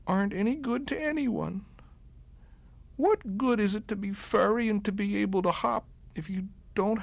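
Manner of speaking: high bunny voice, forlorn; a small bunny giving up on himself; sad little bunny. A narrator's reading. Lonely bunny in the snow despairing, high and sad.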